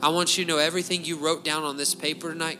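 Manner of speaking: ordinary talk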